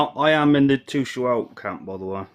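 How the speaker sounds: ordinary talk, nothing marked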